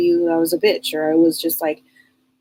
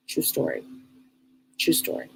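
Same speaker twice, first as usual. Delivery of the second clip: breathy whisper, no voice; whispered aside; 4 semitones lower, 9 dB quieter than usual. Real, not acted. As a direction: lean in and whisper it to yourself.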